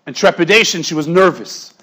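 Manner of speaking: ordinary talk, nothing marked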